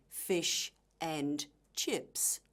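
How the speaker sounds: "fish and chips" in three separate words, long pauses between. Shown as a demonstration. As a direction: say "fish", "and", "chips" one at a time, very slowly. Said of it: "phrases rather than individual words".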